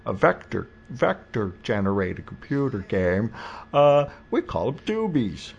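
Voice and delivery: Mid-Atlantic announcer voice, hammed up; old-timey newsreel voice. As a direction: boom it out like a 1940s newsreel narrator. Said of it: "That's a very good old-timey newsreel voice".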